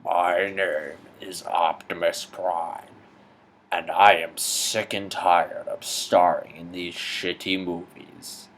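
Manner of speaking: slow, low, grave robot leader voice; slow weary robot hero; Optimus Prime. Acted. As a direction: announce it like a solemn giant robot.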